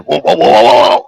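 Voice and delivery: Moe's wail. Retold or imitated. Moe with a nail going through his foot, imitated in loud wails. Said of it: "all of a sudden, he's going".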